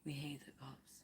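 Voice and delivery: soft whisper. Real, not acted. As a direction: whisper it while hiding. Hiding from cops.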